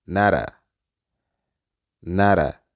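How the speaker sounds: "nara" as "not a" joined, t tapped like r; linked "not a". Shown as a demonstration. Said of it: "se unen y se pronuncia nara". ("nara" unido, con r suave)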